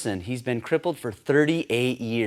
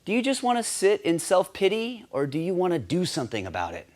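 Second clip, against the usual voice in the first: blunt; 7 semitones higher than usual. Shown as a demonstration. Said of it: "the direct approach".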